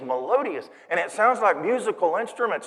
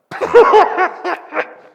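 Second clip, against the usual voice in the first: loud, high-pitched burst of laughing; big performed belly laugh; uncontainable acted laughter; 12 semitones higher, 11 dB louder than usual. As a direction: let the laugh explode out. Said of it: "I couldn't contain myself".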